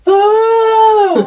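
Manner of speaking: loud, high lurching yell; imitating a junkie's wild shout; sudden cry. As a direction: throw the sound out as she crashes forward. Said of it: "flung herself forward like this and went".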